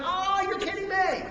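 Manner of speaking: high, drawn incredulous protest; mock disbelief. Buddies hearing he has class, high mock disbelief. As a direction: pitch it up, scoffing at the excuse.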